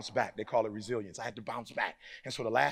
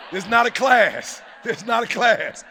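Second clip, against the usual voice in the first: ordinary delivery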